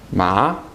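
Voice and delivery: second-tone "Ma" with an upward glide; rising tone. Shown as a demonstration. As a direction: say "Ma" as if really surprised, pitch going up. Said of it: "Your voice goes up".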